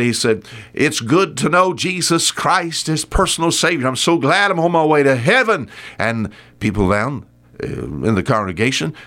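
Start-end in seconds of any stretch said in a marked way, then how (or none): 0.7-5.7 higher-pitched pulpit proclamation; preacher preaching away. Acted. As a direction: imitate a preacher in full swing. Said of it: "he just preaching away".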